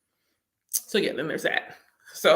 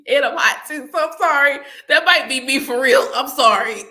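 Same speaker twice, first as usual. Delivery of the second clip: loud apology; 5 semitones higher, 7 dB louder than usual. Real, not acted.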